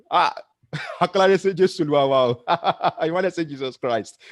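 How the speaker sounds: tripping over a word while laughing; real laughter breaking the words. Real, not acted. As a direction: laugh through the slip, half embarrassed.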